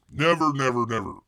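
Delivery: loud, emphatic repeated refusal. Real, not acted. Host swears off cruise ships, loud emphatic refusal. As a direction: hammer out each word loudly.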